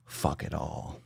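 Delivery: very soft, low whisper; almost voiceless murmur; hushed echo. Pretend. Hushed echo of own words.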